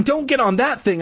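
high alarmed yell. A narrator's reading. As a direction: yell a warning, pitch up. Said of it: "I yelled".

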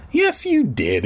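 put-on high, insistent kid; insisting. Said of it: "he insisted".

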